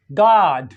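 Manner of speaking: गॉड as "gaad", long open unrounded AH; American open O. Shown as a demonstration. Teacher demonstrating American गॉड to students, long open AH. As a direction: open the vowel of गॉड like the first vowel of father. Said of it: "sounds like father".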